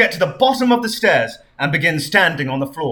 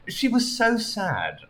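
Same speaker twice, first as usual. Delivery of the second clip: usual speaking voice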